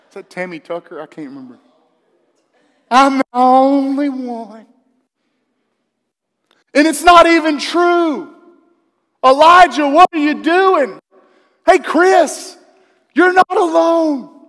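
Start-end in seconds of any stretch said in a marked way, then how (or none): 2.9-4.7 loud, drawn-out mock wail; hammed-up lonely lament, higher and slow; mock self-pity. Mocking Elijah's self-pitying complaint. 6.7-14.4 high, loud, drawn-out rebuke; preacher calling out at full voice; exasperated shout. Acted. Preacher loudly rebukes Elijah and Chris.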